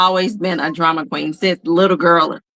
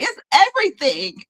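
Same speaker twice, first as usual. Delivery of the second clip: lightly mimicked dramatic child; 8 semitones higher than usual. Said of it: "not the time to be dramatic".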